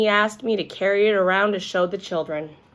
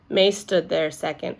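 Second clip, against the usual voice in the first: ordinary delivery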